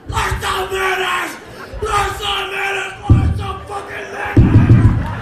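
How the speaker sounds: high, full-force enraged shout; building to an all-out roar; furious screaming. Acted. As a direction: scream it in a slow, drawn-out rage.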